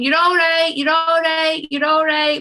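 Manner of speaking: soothing neighbour. Retold or imitated. Neighbour in her dressing gown comforting you, high and soothing.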